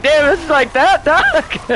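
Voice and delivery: high-pitched, half-laughing alarm; mock panic. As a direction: squeak it out like you're scared of the needle. Retold own panic at injection.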